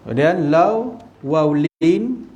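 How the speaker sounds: "lau" and "lin" drawn out with a rising tune; teacher spelling out tajwid letters; teaching pronunciation. Shown as a demonstration. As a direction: stretch out "lau, waw, lin" clearly for students. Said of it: "Dia mat tabri'ah tu".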